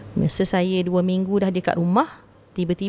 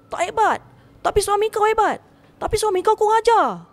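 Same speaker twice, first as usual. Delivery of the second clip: much higher, indignant spirit boasting of its hidden weapons; ranting spirit; 9 semitones higher than usual. Retold or imitated. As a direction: rant high-pitched as the wronged spirit. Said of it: "Ha, dia cakap macam tu". (makhluk halus marah, suara sangat tinggi)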